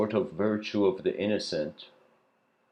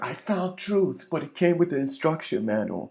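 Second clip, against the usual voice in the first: high mock voice; 9 semitones higher, 3 dB louder than usual. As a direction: lift way up and drag it out. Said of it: "Imagine the person's like".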